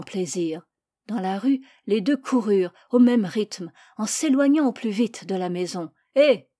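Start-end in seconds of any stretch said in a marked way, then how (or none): 6.1-6.5 sudden loud, higher hail; a raised-voice "hey"; shouted call. A narrator's reading. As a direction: call out sharply to stop someone. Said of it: "cria une voix".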